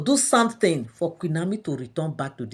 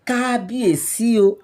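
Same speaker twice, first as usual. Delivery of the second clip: slow, raised call to the king; begging; 5 semitones higher, 6 dB louder, slower than usual. Retold or imitated. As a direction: plead loudly with the king. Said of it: "she was just begging and begging".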